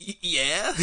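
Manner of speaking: high, stammered unsure yeah; a baffled half-question; mock bewildered yeah; dumbfounded yeah. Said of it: "I'm like".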